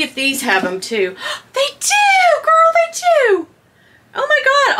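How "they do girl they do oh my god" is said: squealing high with delight; thrilled high squeal; excited. Real, not acted. Excited discovery of tape.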